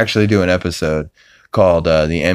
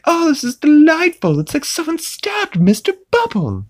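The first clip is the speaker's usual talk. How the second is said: high, delighted British-baby put-on; high gleeful baby voice; Stewie voice. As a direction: savour the gore like a pleased infant villain. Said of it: "he's like".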